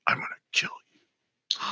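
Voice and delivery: imitated ghostly whisper, very soft; threatening whisper. Mystery voice caught during radio interview, whispered threat. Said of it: "Whispery voice".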